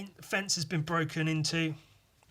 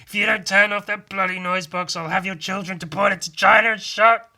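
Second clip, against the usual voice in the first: higher, much louder furious shout; angry president; 6 semitones higher, 12 dB louder than usual. Acted. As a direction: scream the threat at the noise. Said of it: "And then he's angry".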